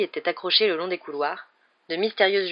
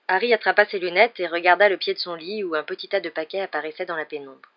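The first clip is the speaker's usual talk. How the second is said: usual speaking voice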